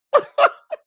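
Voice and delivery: a short, high burst of laughter. Real, not acted. Host laughing at her own outrage, a high burst. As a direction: crack up at yourself.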